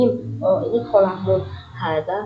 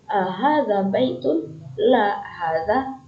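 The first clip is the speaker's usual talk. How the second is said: slow model question and answer; much slower than usual. Shown as a demonstration. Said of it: "Kalau jawabannya bukan".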